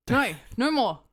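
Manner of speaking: ordinary talk, nothing marked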